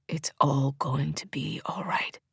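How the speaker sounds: slow, soft whisper close to the ear; whisper in her ear. A narrator's reading. Comforting her with his mouth at her ear, whispered. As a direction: breathe the promise into her ear. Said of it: "puts his mouth next to my ear and says".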